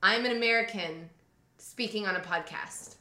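ordinary talk

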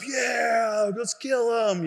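retold hyped-up shout; pumped-up cheer. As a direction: cheer on the fight, all pumped up. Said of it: "we're all pumped up".

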